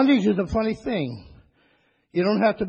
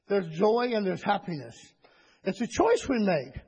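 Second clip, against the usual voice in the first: ordinary delivery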